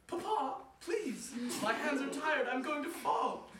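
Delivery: put-on very high, loud girl's plea; a girl slipping; daughter crying out. Daughter to her father, pleading. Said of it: "cried out to her father".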